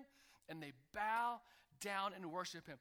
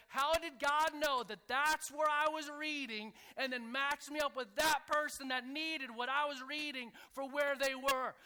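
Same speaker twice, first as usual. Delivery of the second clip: loud, high-pitched burst of wonder; amazed; 6 semitones higher, 9 dB louder than usual. Acted. Preacher voices a believer who sees God match them up, amazed. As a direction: exclaim it at full voice, marvelling.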